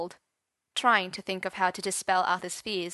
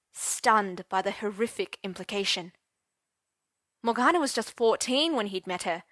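usual speaking voice

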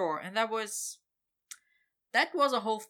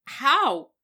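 amazed; 7 semitones higher, 11 dB louder than usual. Acted. Victor amazed at landing.

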